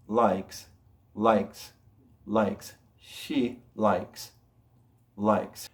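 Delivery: "likes" ending in a voiceless S, lyks; crisp K then hissed S in "likes"; careful "likes" with no buzz at the end. Shown as a demonstration. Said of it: "tal cual va a sonar como S".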